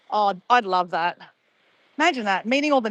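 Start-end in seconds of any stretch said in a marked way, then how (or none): none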